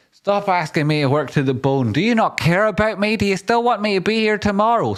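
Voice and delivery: high, whiny put-on plea; mock plea. Complaining to the boss about overwork, high whiny plea. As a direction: pretend to be hard done by.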